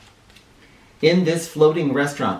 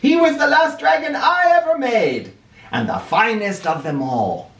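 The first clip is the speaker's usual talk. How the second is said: put-on old Fu voice; 9 semitones higher than usual. Old Fu speaks.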